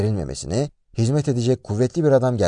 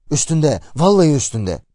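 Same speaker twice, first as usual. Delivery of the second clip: higher, urgent cry of alarm; terrified exclamation; 5 semitones higher, 5 dB louder than usual. A narrator's reading. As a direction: narrator voicing a frightened man's warning. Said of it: "korkudan gözleri dönmüş".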